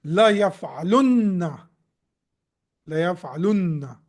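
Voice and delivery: "yaf'alunna" with a long, held nn; "yaf'alunna" ending in a stretched, doubled noon. Shown as a demonstration. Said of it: "how you will say?".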